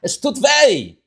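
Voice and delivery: a Rebbe pouring out empathy to his chassid; empathetic Rebbe. Acted. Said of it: "He has to tell the chassid".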